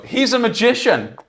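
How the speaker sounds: high, loud showman announcement; hyping introduction. Retold or imitated. Introducing his friend to strangers, a loud high hype. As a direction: sell him big to the crowd.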